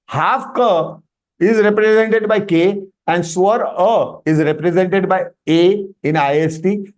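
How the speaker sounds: usual speaking voice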